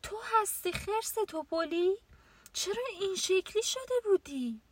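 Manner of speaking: high, wide-eyed little rabbit voice; a small animal asking in amazement; surprised rabbit. A narrator's reading. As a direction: rabbit surprised to find the bear in the costume. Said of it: "خرگوش گفت".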